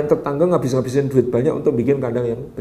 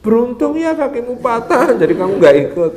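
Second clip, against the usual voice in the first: bitter envious neighbours; 9 semitones higher, 4 dB louder than usual. Acted. Grieving neighbours, bitter envy.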